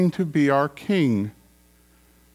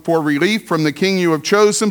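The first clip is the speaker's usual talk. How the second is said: ordinary delivery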